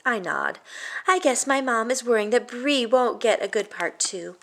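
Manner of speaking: plain reading voice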